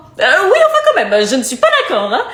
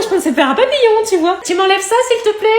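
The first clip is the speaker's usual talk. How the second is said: ordinary talk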